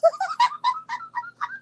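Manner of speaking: very high laugh; high squealing laugh. Real, not acted. Recalling his bus threat, squealing laugh.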